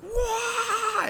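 high, drawn-out sung wail; imitated song scream. Fan imitating the song's scream, long high wail. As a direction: hold a long high "waah" like the singer. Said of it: "when he's like".

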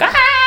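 high, loud "ah" of mock panic; mock terrified scream. Imitating everyone at Kundalini awakening, mock scream. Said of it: "everyone's like".

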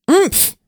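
startled grunt through a hand; muffled oomph. Acted. Jamie tackled, mouth covered.

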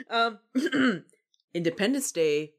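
usual speaking voice

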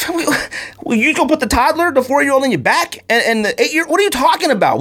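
high, disbelieving; can't believe what he's hearing; incredulous. Retold or imitated. Husband answering his wife's plan in high disbelief. Exasperated reply to wife.

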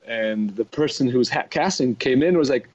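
normal talking voice

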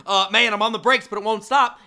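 high, loud alarmed plea; acted-out panicky warning; retold panic. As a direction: call out as the car gets away from you. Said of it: "I say to the owner".

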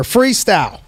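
ordinary talk, nothing marked

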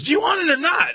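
high shouted ultimatum; mock yell. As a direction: snap it at him impatiently. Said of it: "she's, like, yelling at this guy".

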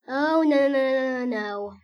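panicked no's, loud then fading; frightened cry. Acted. Duck panics at the spill, loud frightened cry. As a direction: cry out in fright, dying away. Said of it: "I hear someone quack frightenedly".

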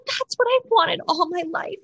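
high-pitched, swooning declaration; dramatic Claire. Retold or imitated. Co-host mimicking how she talks on the show, dramatic. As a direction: gush it high like a soap opera star. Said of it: "that's how she talks".

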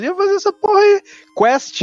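sudden high exasperated burst; exasperated outburst. Real, not acted. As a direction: blow up in frustration. Ranting about delivery games.

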